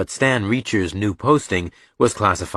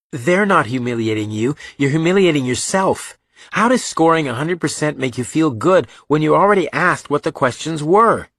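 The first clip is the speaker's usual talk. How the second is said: put-on higher, louder, heated young voice; Reacher, challenging his brother; 7 semitones higher, 4 dB louder than usual. A narrator's reading. Reacher to Joe about the test questions, exasperated challenge. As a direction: argue it hard, pressing the point.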